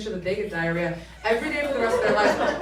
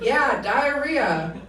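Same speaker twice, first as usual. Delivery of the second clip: slow, raised, emphatic answer; loud confirmation; 4 semitones higher, 4 dB louder, much slower than usual. Retold or imitated. Cop confirming to the woman on the phone, slow and emphatic. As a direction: confirm it slowly and publicly, pitched up.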